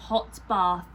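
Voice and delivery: ordinary delivery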